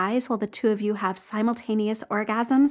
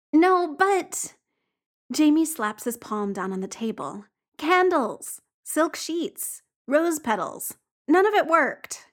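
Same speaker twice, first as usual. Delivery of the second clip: higher, urgent protest; a frustrated boyfriend pleading his case; exasperated insisting; 5 semitones higher, slower than usual. Acted. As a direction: insist it's not funny.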